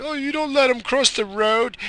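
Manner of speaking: mock outrage at the driver; mocking upset people. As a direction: mimic the offended bystanders. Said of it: "These people get all mad".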